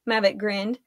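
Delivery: plain reading voice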